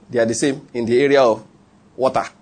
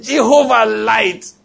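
high, long-stretched mock shout; imitating a brother's fervent outburst; excited shout; 7 semitones higher, much slower than usual. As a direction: stretch the cry out high and slow. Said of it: "He just shouted".

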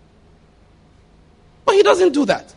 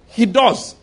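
usual speaking voice

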